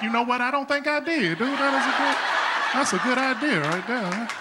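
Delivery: high-pitched dumbfounded earnest reply; high, naive agreement; acting stupid. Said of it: "it made me stupid".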